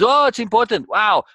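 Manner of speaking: high, excited voice of the brain; the brain perking up, amazed; impressed brain. Acted. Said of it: "And the brain says".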